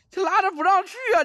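high, loud exclaiming; excited cry. Real, not acted. Streamer telling viewers the ghost pulls him, high excited cry. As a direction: cry out about being held back. Being dragged back.

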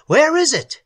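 high, loud, urgent demand; shouted question. A narrator's reading. Algernon searching for the creature, shouting. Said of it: "he shouted".

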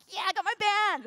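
loud, high victory yell; re-enacted elated shout; triumphant cheer. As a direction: whoop it out like you've won. Celebrates receiving the band.

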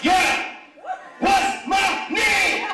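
loud, high rap shout, slow. Acted. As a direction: bark it like a rapper hyping. Shouting during sex as [name].

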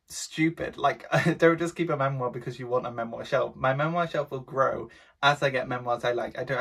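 usual speaking voice

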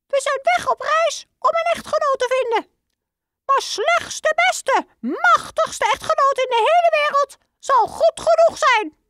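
very high, squeaky little mouse voice; high mouse voice ending in a piep; squeaky father mouse. Acted.

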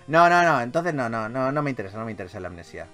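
ordinary talk

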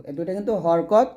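normal talking voice

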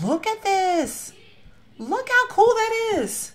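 high, slow, drawn-out awe; delighted squeal. Real, not acted. Pointing out a cool detail, high drawn-out delight. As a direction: stretch it out like you can't believe it. Gushing over a house tour.

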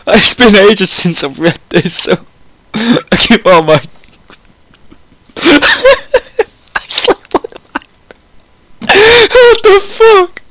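squeaky high, words collapsing into laughter; helpless laughter. Real, not acted. Reader unable to continue the story, laughing helplessly. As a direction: try to speak while cracking up.

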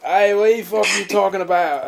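high-pitched mock Australian accent; put-on Australian accent. Shown as a demonstration. Proving to friends he can do it, hammed-up Australian accent. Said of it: "I know how to do an Australian accent".

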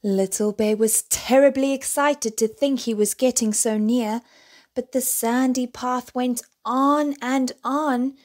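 ordinary delivery

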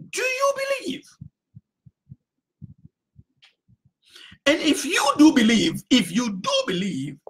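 a fervent preacher's shout; preaching shout. Acted. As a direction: fling the question at the congregation.

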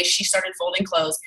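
ordinary delivery

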